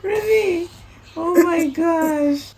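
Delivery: high, delighted oh my gosh; high excited welcome; excited greeting. Real, not acted. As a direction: gush with joy at seeing him. Mother greeting son excitedly.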